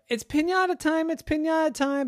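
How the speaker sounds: 高声欢快地招呼大家; host calling out. A narrator's reading. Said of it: "the host said".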